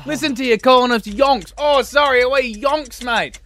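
Australian-ish American. Retold or imitated. Americans mocking a friend back from Britain, hammed-up Aussie accent. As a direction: tease them in a daft Aussie-sounding Yank voice. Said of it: "not sure why the Americans had an Australian accent".

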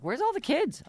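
high-pitched imitation of puzzled children; puzzled kids. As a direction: ask it high, like a baffled kid. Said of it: "They're like".

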